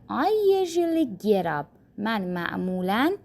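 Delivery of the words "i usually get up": slow, clear English model; slow model sentence. Shown as a demonstration. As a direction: say each word slowly for learners. (آهسته و شمرده)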